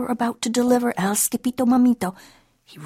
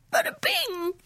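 very high, softer frightened wail; wailing kitten; 5 dB quieter than usual. A narrator's reading. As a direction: wail it thinly, like a scared kitten. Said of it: "he wailed".

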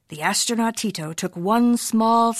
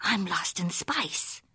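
slow, worried whisper; whispering to himself; 7 dB quieter, slower than usual. Acted. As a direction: whisper it to yourself, a little scared. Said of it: "Skipito said to himself".

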